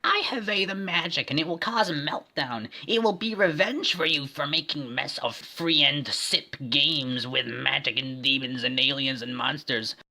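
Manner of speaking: high, put-on villain woman. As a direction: sneer it as the bad woman. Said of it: "Bad Woman from the film said".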